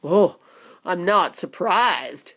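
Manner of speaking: slow, dry father; lightly voiced weary father; father dryly unsurprised. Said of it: "said Mister Beauregard".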